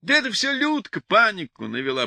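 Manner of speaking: usual speaking voice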